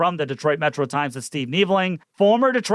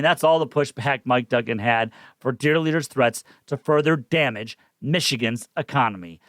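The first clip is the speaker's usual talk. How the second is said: normal talking voice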